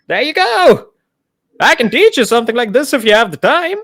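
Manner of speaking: high cheerful skeleton voice; lich voice. Acted. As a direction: offer to teach, chirpy. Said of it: "he's just like".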